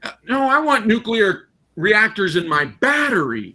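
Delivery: ordinary delivery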